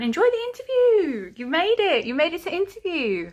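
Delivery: over-the-top celebratory squeal; hammed-up cheering. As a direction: cheer them on, stretching the words high.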